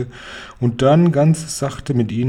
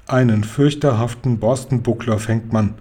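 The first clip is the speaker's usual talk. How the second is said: ordinary delivery, nothing marked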